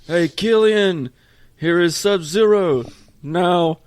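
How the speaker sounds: loud, high, drawn-out movie quote impression; action-hero one-liner. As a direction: deliver a hammed-up action-movie taunt, slowly and forcefully. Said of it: "where he goes".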